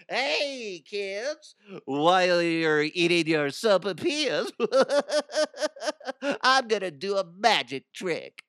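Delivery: high, cackling clown voice; clown in the background. Pretend. Clown interrupting diners at Casa Bonita, high cackling voice. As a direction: play a creepy giggling clown announcing a trick. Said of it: "you hear in the background".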